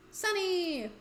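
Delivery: a droopy, sad call of the name; sad Sunny. Acted. Friend finding Sunny, calling her name sadly. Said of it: "Oh, that was a sad Sunny".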